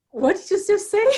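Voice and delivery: high, loud mock-baffled question; husband's puzzled question. Imitating her husband asking what she just said, mock-puzzled. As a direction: play the husband who didn't catch a word. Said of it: "my husband say".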